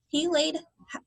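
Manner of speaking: ordinary delivery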